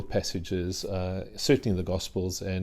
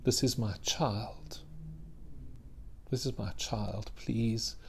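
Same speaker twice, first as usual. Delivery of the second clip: soft, slow, broken pleading; desperate plea; 9 dB quieter, slower than usual. Acted. Kneeling mother begs Jesus to heal her child, softly pleading. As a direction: beg quietly for your child. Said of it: "she's kneeling, she's desperate".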